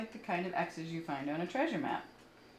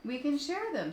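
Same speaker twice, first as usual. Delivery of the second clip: friendly suggestion; 10 semitones higher than usual. Acted. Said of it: "suggested the friendly ladybug".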